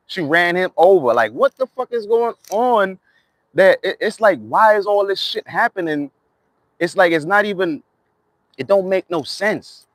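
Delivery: real bewildered exasperation. Real, not acted. Exasperated outburst about deaths.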